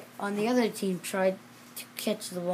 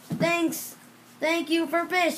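cheerful seals; 8 semitones higher, 5 dB louder, slower than usual. Acted. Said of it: "said the seals".

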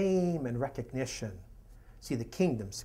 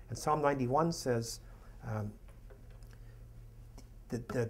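normal talking voice

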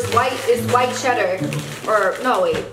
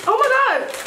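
excited; 10 semitones higher than usual. Real, not acted. Loves the cheese snack.

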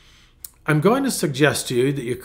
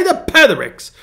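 The Pethericks said higher with a British lilt; a self-mocking go at a British accent; feeble British accent; 9 semitones higher, 5 dB louder than usual. Shown as a demonstration. Pastor showing off his British accent on The Pethericks. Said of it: "very feeble attempt at a British accent".

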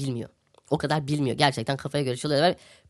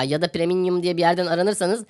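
usual speaking voice

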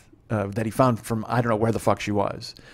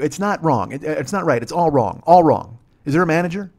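indignant complaint; 4 semitones higher, 6 dB louder than usual. Retold or imitated. Acted quarrel over a wrong order.

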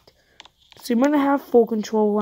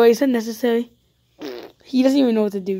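ordinary delivery

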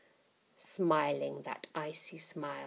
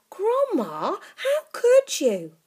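put-on higher, louder appalled boy; 14 semitones higher, 9 dB louder, slower than usual. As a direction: cry out in horror like a boy.

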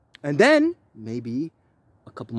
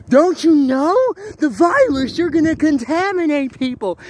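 high, loud mock alarm; mocking the woman; 9 semitones higher, 7 dB louder than usual. Mocking the woman who said the virus spreads. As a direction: mimic her scandalised warning. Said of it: "And she's like".

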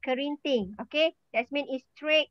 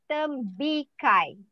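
Tembikai drawn out syllable by syllable; slow model pronunciation; much slower than usual. Shown as a demonstration. Teacher modelling a fruit name for the class, slow pronunciation. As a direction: say Tembikai slowly for learners to copy. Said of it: "try to pronounce it".

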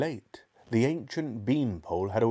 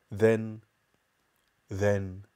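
plain reading voice

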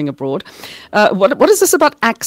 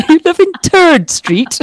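high, slow mock disbelief; incredulous quote in a high voice; 9 semitones higher, 3 dB louder, slower than usual. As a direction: act shocked, stretch it out. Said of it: "everybody's like".

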